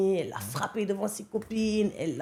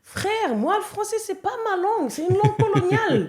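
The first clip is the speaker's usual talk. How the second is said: much higher and louder, insisting; fired-up protest; 11 semitones higher, 5 dB louder than usual. Real, not acted. Guest telling the host French is colonial, high and heated. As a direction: push it up high, half-laughing at the absurdity.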